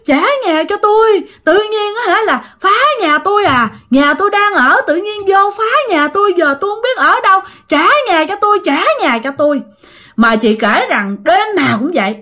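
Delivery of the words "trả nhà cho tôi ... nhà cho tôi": high, drawn wailing ghost voice; plaintive ghostly reproach. Acted. Ghost haunts the couple at night demanding her house, high plaintive reproach. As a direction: moan the demand in a high, aggrieved voice. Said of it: "có lúc á thì ai oán lên mà trách móc nha".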